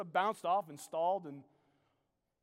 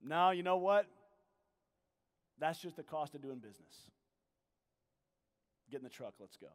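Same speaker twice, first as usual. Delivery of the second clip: a hint of an easygoing uncle letting it go; matter-of-fact; 6 semitones lower than usual. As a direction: shrug it off plainly, no blame. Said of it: "saying very matter-of-factly".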